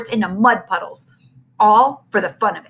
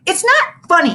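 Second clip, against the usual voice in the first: loud, high holler; upset boy hollering at his classmate; 11 semitones higher, 6 dB louder than usual. A narrator's reading. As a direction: yell it at the teaser. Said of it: "hollered Ashley".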